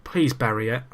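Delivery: ordinary talk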